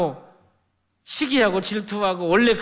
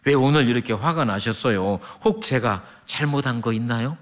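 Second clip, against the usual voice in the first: gentle junior's words; 8 semitones lower than usual. Retold or imitated. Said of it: "이렇게 말을 하더라는".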